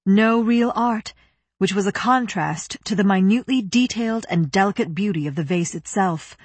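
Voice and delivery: ordinary delivery, nothing marked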